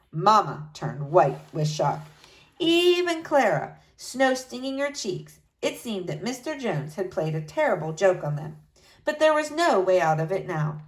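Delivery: ordinary delivery, nothing marked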